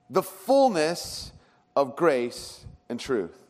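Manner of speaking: very slow, weighty delivery; slow emphasis. Real, not acted. Preacher driving home the key phrase to the church, slowly. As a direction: lay each word down slowly.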